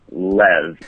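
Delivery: Lev said alone, as one short syllable; correct pronunciation. Shown as a demonstration. Guest correcting the hosts on his own name, Lev pronounced carefully. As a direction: give Lev by itself after a pause, as a correction. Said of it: "So it's actually pronounced".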